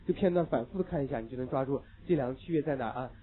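ordinary talk, nothing marked

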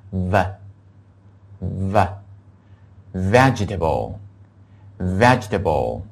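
slow V with tongue tip between the teeth, vegetable; vegetable read very slowly, V with tongue between teeth; V sound drilled. Shown as a demonstration. Teacher drilling students: slow V for vegetable. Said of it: "跟我读一下".

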